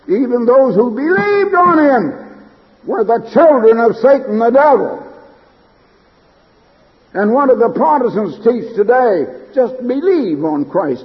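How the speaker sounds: high, loud pulpit proclamation; forceful preaching. Real, not acted. Preacher challenges what Protestants teach today, forceful high preaching. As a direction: proclaim it with urgent emphasis, pitched well up.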